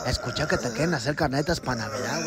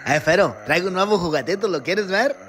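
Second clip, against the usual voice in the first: normal talking voice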